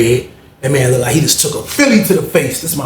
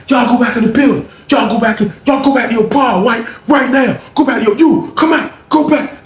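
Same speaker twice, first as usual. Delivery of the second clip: mock guard shouting, high pitched; CO shouting orders; 9 semitones higher than usual. CO yelling inmates back inside.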